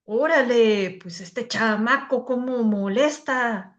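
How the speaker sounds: put-on singsong Mexican accent with stretched endings; put-on Mexican Spanish. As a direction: imitate a Mexican, dragging out the last word. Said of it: "O un mexicano".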